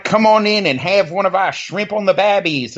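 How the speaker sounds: imitated Australian ad guy. Recalling the Outback commercials to co-host, mock Australian accent. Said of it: "You know that guy".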